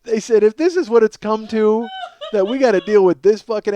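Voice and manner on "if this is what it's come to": ordinary talk, nothing marked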